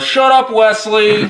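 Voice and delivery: high, slow mock yell. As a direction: bark it out in a put-on high voice. Mock yelling at Wesley.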